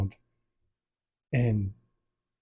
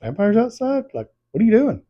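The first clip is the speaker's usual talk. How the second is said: high-pitched, louder mock disbelief; acted-out baffled protest; imitated incredulity; 9 semitones higher, 3 dB louder than usual. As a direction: question her like she's lost her mind. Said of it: "He's like".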